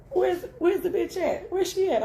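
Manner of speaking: high mock angry mom voice; mom demanding. Imitating mama hunting the other woman at the funeral. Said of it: "she's like".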